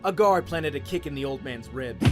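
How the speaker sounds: ordinary delivery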